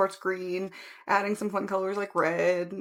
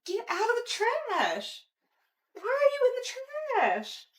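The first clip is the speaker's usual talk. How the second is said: scolding the cat; 13 semitones higher than usual. Real, not acted. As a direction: shoo the cat out of the trash.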